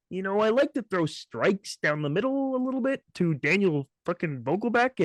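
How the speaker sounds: high, scruffy mock voice; mock him with a squeaky scruffy voice; mock Gerrit Cole. Fan imitating Gerrit Cole's complaint to his co-host, high scruffy mock voice. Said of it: "Gerrit Cole's like".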